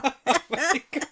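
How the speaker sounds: high, slow oh my God; amazed. Real, not acted. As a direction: gasp it out in disbelief. Reacting to the Edain voice.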